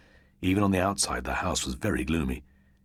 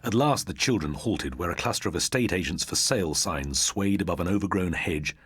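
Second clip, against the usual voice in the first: usual speaking voice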